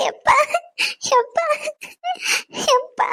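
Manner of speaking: tearful broken calls of dad; crying to father. Acted. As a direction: sob out dad, voice cracking.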